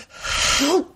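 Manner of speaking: high-pitched starstruck disbelief; fanboying out. Retold or imitated. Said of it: "totally, like, fanboying out".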